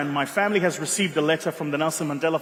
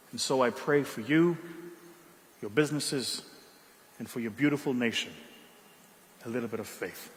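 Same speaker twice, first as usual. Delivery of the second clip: sincere blessing; 4 semitones lower, 6 dB quieter than usual. Real, not acted. Closing blessing to audience.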